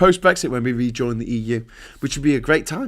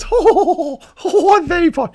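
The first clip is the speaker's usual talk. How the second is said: high, loud mocking hoot; hammed-up mock lament in a high put-on voice; James O'Brien; 6 dB louder than usual. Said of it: "James O'Brien goes".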